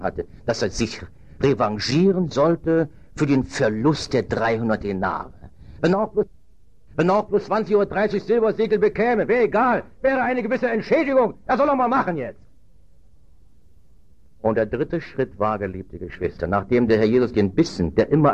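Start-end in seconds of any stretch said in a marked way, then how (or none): 5.8-12.4 high-pitched, hurried inner voice of Judas; scheming self-justification. Acted. Preacher voices Judas reckoning the silver pieces, self-justifying. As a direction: talk yourself into it, pitched high and quick.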